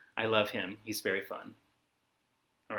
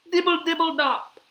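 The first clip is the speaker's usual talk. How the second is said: high, pattering dibble-dops; rain sound; 7 dB louder than usual. Acted. Reader tries rain for kids, high pattering. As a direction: patter it out like raindrops. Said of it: "He can sound like the rain".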